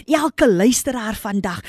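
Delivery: usual speaking voice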